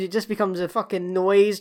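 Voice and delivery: usual speaking voice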